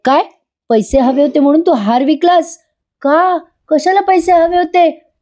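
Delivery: stunned, pressing for a reason; shocked demand. Retold or imitated. Partha demanding why Jiva sold the necklace, shocked and high.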